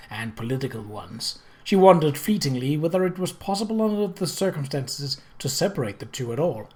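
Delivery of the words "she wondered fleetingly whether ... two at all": ordinary delivery, nothing marked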